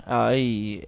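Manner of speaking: ordinary delivery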